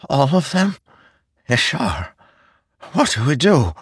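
high, anxious questioning; alarmed. Acted. Nale asks Ishar what they should do, alarmed. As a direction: plead for guidance in dismay.

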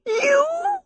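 a high shocked shriek. A narrator's reading. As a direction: shriek it in disbelief. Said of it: "Sophie shrieked".

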